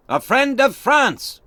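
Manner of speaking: high, loud and slow answer; called reply. A narrator's reading. Duncan answering the French sentry, slow loud call.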